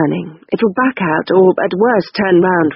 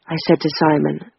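usual speaking voice

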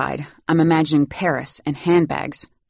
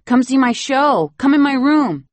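tug at mom to come see; excited child begging; 10 semitones higher, 7 dB louder than usual. Acted. Boy begging his mother to see his show, excited.

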